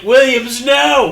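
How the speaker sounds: loud, high, laughing shout; panicked yell. Retold or imitated. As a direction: yell a warning, too late. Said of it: "he's, he's like".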